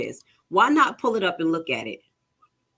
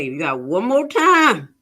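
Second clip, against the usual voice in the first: stern warning; 2 semitones higher, 7 dB louder, slower than usual. Acted. Paraphrasing the notice's threat.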